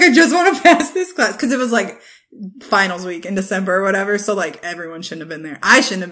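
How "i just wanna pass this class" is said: quoting him through a laugh, higher; laughing quote. Retold or imitated. Friend retelling a student at the show, laughing. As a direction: giggle through his line. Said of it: "he was like".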